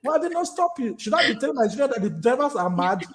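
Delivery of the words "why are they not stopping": high-pitched insistent kid, imitated; hammed-up bewildered child voice; questioning child. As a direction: mimic a child pestering Daddy with questions. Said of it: "because they will be asking".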